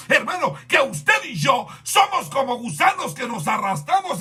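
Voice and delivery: high, ringing sermon delivery; impassioned, high-pitched preacher's cry; full-force preaching. Real, not acted. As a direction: drive each phrase home to the congregation.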